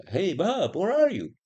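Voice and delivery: high, loud hammed-up call to a friend; mock thought message. Future man thinking aloud to his friend Bob, mock high call. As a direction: sing out the question way up high, as a mock telepathic hail. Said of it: "This is my thought".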